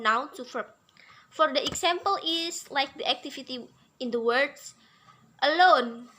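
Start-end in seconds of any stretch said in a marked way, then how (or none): 5.4-6.1 "alone" with the second syllable stretched and louder; stress demo. Shown as a demonstration. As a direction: lean on the end of "alone". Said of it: "So the intonation is".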